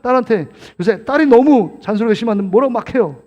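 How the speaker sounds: ordinary talk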